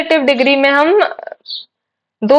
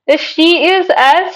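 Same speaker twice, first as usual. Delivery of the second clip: dictation pace; 4 semitones higher, much slower than usual. Shown as a demonstration. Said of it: "dictating example sentence".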